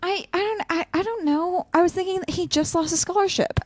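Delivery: high, halting and distressed; upset stammer. Acted. Ace explaining the jailbreak to an upset friend, distressed. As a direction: stumble over the words, close to tears.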